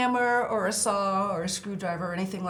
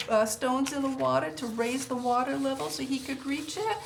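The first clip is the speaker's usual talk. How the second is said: ordinary talk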